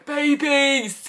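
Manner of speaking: high cooing "Babies"; gushing. Pretend. To the tiny kittens, a high gushing coo. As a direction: coo like a kitten-crazed fan.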